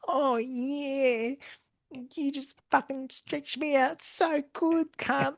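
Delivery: high, slow hammed-up satisfaction; mock Jada. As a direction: ham up her satisfied purr. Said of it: "she was like".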